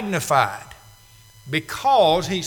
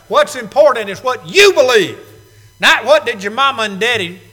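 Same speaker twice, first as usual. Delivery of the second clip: emphatic preaching; 3 semitones higher, 6 dB louder than usual. Real, not acted.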